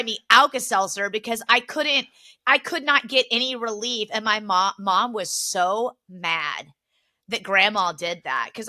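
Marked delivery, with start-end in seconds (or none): none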